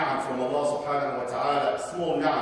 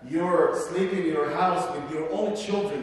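normal talking voice

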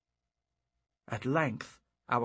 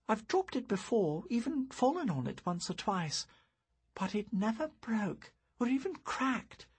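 put-on higher, puzzled girl's voice; young girl; 10 semitones higher than usual. Girl musing to her friend about the broken orb, high puzzled voice. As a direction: wonder aloud like a young girl.

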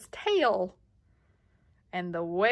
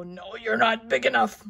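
grouchy loud retort; 3 semitones higher, 7 dB louder than usual. Acted. Grouchy ladybug dismisses gorilla.

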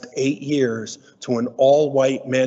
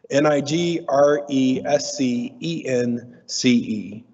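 slow letter-by-letter spelling; slower than usual. Shown as a demonstration. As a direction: spell each letter out for the class. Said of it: "Today's new word".

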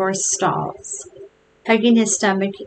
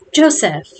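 a stern father calling his son, pitched higher; higher, falling call from Pa; 5 semitones higher, 5 dB louder than usual. A narrator's reading. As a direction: call out the name like a displeased father.

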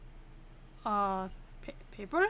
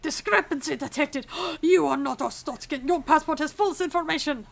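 loud, hammed-up outrage at forged papers; gasp, then loudly denounce the traveller as a pretend official; play-acted indignant inspector; mock accusation; 3 semitones higher, 6 dB louder than usual. Acted inspector catching false papers.

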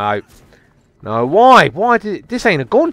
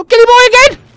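very high, loud delighted squeal; gleeful shriek; 8 dB louder than usual. Real, not acted. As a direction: shriek at finding the same victim. Spots the same victim again.

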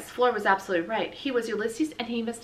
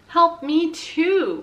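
slow plea; 9 semitones higher, 8 dB louder, much slower than usual. A narrator's reading. Squirrel's desperate thought.

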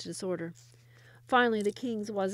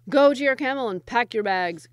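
much louder royal command; furious cry; 2 semitones higher, 10 dB louder than usual. A narrator's reading. King dismissing his wazir, loud and furious. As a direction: cry out the order in anger. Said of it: "cried the king".

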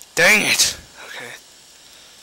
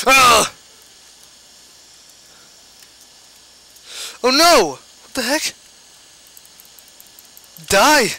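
high loud frightened yells; panicked shrieking; 8 semitones higher, 10 dB louder than usual. Real, not acted. Attacked, panics.

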